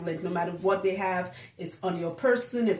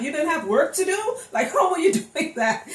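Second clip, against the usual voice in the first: high, loud disbelieving question; laughing in disbelief; incredulous; 8 semitones higher, 3 dB louder than usual. Retold or imitated. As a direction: act out your shock at the client.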